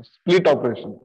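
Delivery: normal talking voice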